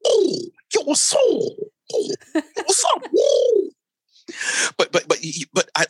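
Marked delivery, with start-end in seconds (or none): 0.0-3.7 high, slow pigeon voice; drawn-out high pigeon voice; street pigeon voice. Retold or imitated. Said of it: "they're like going".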